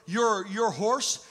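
ordinary delivery

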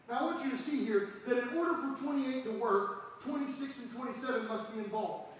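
quiet. Real, not acted. Suddenly much quieter.

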